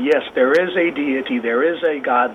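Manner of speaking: normal talking voice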